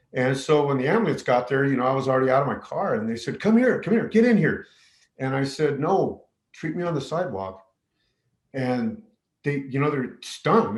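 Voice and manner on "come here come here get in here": higher and louder, hurried beckoning; mock-urgent shouting; urgent calling. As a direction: call him over like a frantic medic. Paramedics calling him over.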